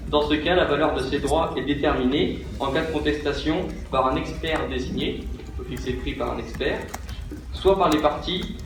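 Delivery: usual speaking voice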